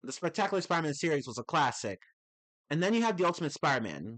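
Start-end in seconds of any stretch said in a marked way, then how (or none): none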